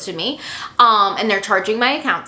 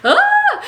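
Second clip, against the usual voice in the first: sudden shrieking laugh, much higher and louder; high squealing laugh; 6 dB louder than usual. Real, not acted. Laughs at her own rant.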